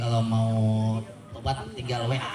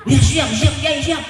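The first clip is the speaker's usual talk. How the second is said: high, loud eager servant voice; eager yes-sir; 11 semitones higher, 6 dB louder than usual. Retold or imitated. Driver answering the Kiai with a high, loud eager "siap".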